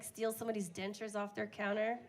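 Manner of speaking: ordinary delivery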